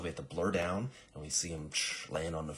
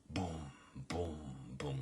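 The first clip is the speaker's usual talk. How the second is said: soft, resonant imitated thuds; heavy footstep booms; 7 dB quieter, slower than usual. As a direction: sound out each heavy step. Imitates big guy's footsteps.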